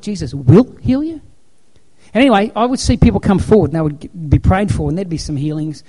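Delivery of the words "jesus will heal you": "Jesus will heal you?" asked slowly, higher, rising in disbelief; doubtful question. Retold or imitated. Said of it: "I caught on to it".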